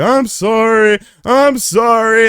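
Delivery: high, slow, whimpering sorry; pitiful apology. Retold or imitated. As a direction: drag it out like a guilty kid. Apologising to disappointed parents.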